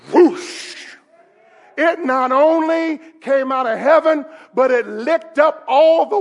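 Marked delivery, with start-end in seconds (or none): 0.1-0.7 sudden imitated rush of flame; whoosh of fire. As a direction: burst out a breath-filled whoosh. Imitates fire falling from heaven.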